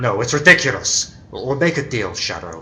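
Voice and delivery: high, loud, rising panic; trapped villain losing his nerve; panicked. Acted. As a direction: blurt it out in sudden fear.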